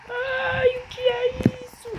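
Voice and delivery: squealing high in alarm. Real, not acted. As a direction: yelp it out high. Panicked squeal in game.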